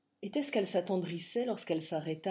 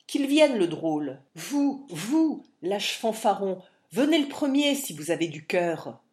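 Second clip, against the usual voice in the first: defiant challenge; 2 semitones higher, 7 dB louder than usual. Acted. Barry dares them to fight.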